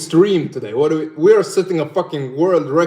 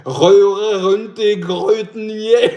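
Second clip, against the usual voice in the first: slow, higher, hammed-up Scanian accent; mock Skåne accent; 6 semitones higher, slower than usual. Streamer repeating his Skåne parody, slow and mocking. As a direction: drag out a parody of Skåne speech. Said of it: "I'm mocking the skånska people".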